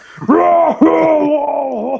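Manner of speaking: loud, high mocking laugh of buddies. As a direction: burst out laughing at the guy. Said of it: "started laughing their asses off".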